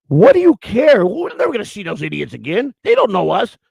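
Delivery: high, loud mock outburst; loud and obnoxious. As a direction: re-enact an obnoxious public protest. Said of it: "I'll just get really loud and obnoxious".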